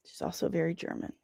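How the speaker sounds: muttered afterthought; quiet repeat. Shown as a demonstration. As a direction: drop it as a quick soft aside.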